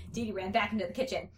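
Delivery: usual speaking voice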